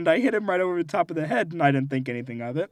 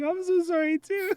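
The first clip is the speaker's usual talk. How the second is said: put-on high, sing-song kid apology; sweet little-kid sorry; childish apology; 13 semitones higher than usual. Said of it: "he's like".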